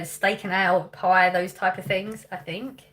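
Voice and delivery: ordinary talk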